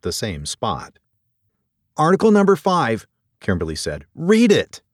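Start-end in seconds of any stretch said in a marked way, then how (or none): 2.0-3.1 high, loud and pressing; urgent insistence. Acted. Docent urgently showing curator the document. 4.2-4.8 high, loud, insistent command; urgent order. Acted. Docent orders curator to read.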